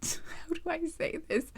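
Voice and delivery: breathy giggling whisper; a sheepish whispered aside. Real, not acted. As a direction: whisper it, half laughing. Embarrassed pause in reading.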